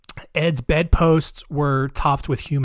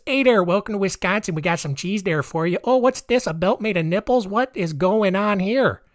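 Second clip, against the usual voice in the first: hammed-up Wisconsin accent, pitched higher; a cheerful local in a mock Wisconsin accent, going higher in surprise; bad Wisconsin accent; 5 semitones higher than usual. Vlogger mocks Wisconsin locals finding the belt, in a bad Wisconsin accent. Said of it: "that's my bad Wisconsin accent".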